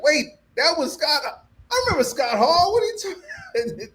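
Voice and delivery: high-pitched, incredulous squeal breaking into laughter; acted disbelief. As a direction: replay baffled astonishment, pitch climbing. Retelling own disbelief while watching.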